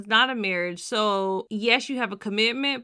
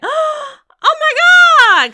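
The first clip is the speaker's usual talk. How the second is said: surprised gasp; 13 dB louder, much slower than usual. Retold or imitated. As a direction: gasp then high, loud oh my God. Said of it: "are you not going like".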